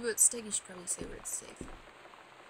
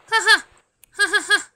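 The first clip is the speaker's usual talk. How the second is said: big hammed-up laugh, much higher and louder; loud mock laugh; 8 semitones higher, 18 dB louder, faster than usual. Gamer laughing loudly at the spooky house, hammed-up cackle. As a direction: cackle it out like a cartoon villain.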